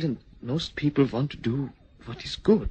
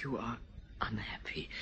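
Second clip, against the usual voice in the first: soft; 3 semitones lower, 12 dB quieter than usual. Acted. Gentle realisation.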